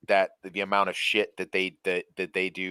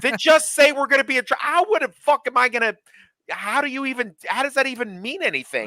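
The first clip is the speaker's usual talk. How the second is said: exasperated retort; 5 dB louder than usual. Retold or imitated. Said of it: "I'm like".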